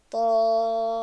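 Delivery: طامة recited loud, the alif held long before the doubled m; tajweed recitation of طامة with a long madd; six-count madd. Shown as a demonstration. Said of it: "خب، دراز کردیم".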